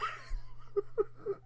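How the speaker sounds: very high, breathy shriek of laughter. Real, not acted. Bursting into laughter.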